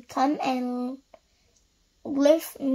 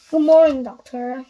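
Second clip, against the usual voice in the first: loud greeting; 8 dB louder than usual. Acted. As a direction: boom the greeting back at him. Mister Wrong replies to postman.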